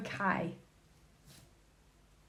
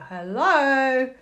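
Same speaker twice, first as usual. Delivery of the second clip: mocking taunt; 5 semitones higher, 6 dB louder, much slower than usual.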